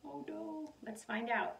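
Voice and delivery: ordinary delivery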